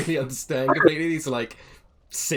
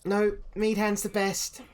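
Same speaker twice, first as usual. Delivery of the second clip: put-on high, slow stubborn child voice; child insisting to the bard; insistent kid; 9 semitones higher, slower than usual.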